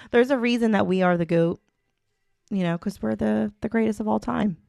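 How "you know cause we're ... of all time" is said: ordinary talk, nothing marked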